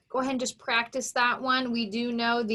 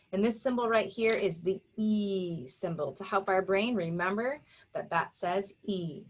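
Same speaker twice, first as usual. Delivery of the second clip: ordinary delivery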